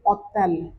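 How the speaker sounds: ओतल with silent h; French hôtel. Shown as a demonstration. Teacher models ओतल, dropping the h. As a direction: say ओतल, no h at start. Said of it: "इसको आप बोलोगे".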